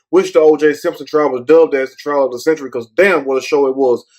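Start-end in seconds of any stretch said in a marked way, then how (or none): none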